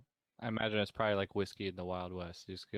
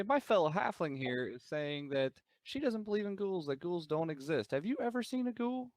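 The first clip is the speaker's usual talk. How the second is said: markedly higher-pitched, in-character question; 8 semitones higher than usual. Acted. Asking a stranger to settle a ghoul bet, high-pitched. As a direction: pitch it up high, rising at the end.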